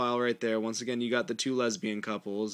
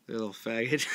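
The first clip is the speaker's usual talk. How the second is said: laughing; 7 semitones higher, 4 dB quieter than usual. Real, not acted. Breaks into laughter while talking.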